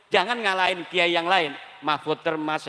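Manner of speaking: normal talking voice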